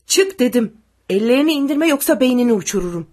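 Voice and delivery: loud, fast soldier's threat; rapid, forceful shouting; shouted threat. A narrator's reading. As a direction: yell an order at gunpoint. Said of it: "diye bağırdı".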